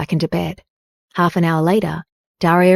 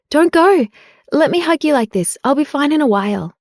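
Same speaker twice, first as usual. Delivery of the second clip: a touch of strain, voice pitched up and pleading; 7 semitones higher than usual. A narrator's reading. Wife begging her husband not to leave, strained and pleading. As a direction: hold him back with a tight, higher plea. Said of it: "she said in a strained voice".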